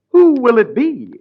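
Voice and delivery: normal talking voice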